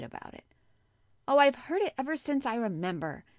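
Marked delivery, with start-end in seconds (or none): 1.3-3.2 loud, higher-pitched cross answer. A narrator's reading. Boy answers unsympathetic girl about dying, crossly. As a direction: snap back crossly. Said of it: "he answered crossly".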